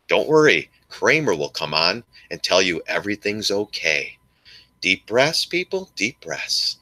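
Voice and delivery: slow, soothing breathing-coach patter; mock reassurance. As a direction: talk them down with drawn-out mock calm. Mock calming talk to panicked traders.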